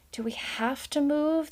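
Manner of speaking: high, whiny little-mouse voice; a small child complaining about moving. A narrator's reading. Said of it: "the first complained".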